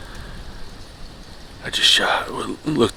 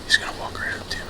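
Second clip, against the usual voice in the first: whispering; 8 semitones higher, 10 dB quieter than usual. Real, not acted. Whispering as second buck approaches.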